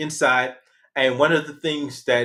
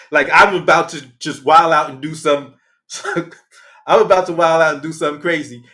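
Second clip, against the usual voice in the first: normal talking voice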